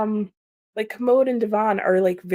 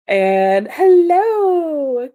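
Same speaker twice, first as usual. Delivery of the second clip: drawn-out hello; 8 semitones higher, 8 dB louder, much slower than usual. Real, not acted. Greeting a new chatter.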